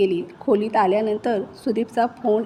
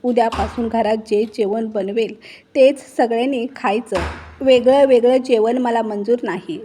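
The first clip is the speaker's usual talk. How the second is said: usual speaking voice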